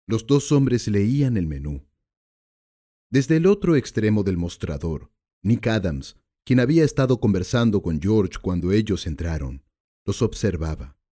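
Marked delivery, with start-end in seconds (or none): none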